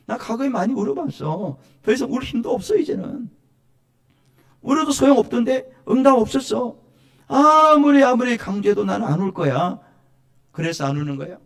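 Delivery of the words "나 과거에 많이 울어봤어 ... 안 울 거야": higher-pitched voice of a weary holdout; mild protest rising to one loud burst; acted stubborn refusal. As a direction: lightly act someone who has given up on crying out. Acting a believer who refuses crying.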